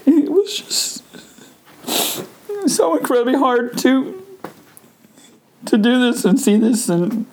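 pushing words out through tears; real crying while talking. Real, not acted. Crying recalling mother's death.

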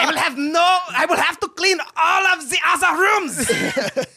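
drawn-out mock German accent. Player reading the sentence, in a German accent. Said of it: "with a German accent".

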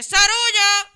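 high, loud kitchen call; calling out orders. Retold or imitated. Cook at the food window shouts orders over the set, high and loud. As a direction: yell the order across the restaurant.

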